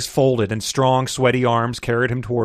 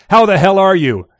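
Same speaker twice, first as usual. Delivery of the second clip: hearty greeting; 7 semitones higher, 8 dB louder than usual. A narrator's reading.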